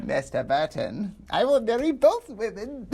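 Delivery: put-on high-pitched old villain voice; Lo Pan voice. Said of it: "that high-pitched ... voice".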